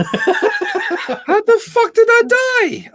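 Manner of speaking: high, drawn-out exclamation of disbelief; exasperated, high-pitched protest; outraged gamer cry. Real, not acted. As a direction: wail in outrage at an unfair game death. Outraged at dying in a game.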